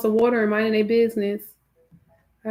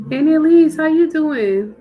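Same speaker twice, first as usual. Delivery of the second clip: higher and louder happy hello; excited greeting; 6 semitones higher, 9 dB louder than usual. Real, not acted. Host greeting a friend arriving in the chat, higher and louder. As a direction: call out to a friend who just showed up. Greets viewer joining the stream.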